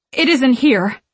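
distraught witch exclaiming; upset exclamation. A narrator's reading. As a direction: burst out in outraged alarm. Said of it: "she exclaims, voice shaking".

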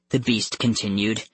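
plain reading voice

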